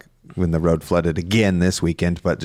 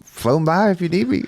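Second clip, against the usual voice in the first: hammed-up country accent; 7 semitones higher, faster than usual. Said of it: "I don't know why you have this accent".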